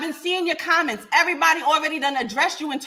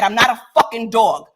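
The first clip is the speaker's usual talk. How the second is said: ordinary delivery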